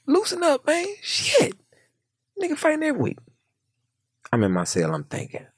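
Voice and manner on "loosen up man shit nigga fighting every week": squeaky high teasing; high mocking voice. Inmate teasing him to loosen up, high voice.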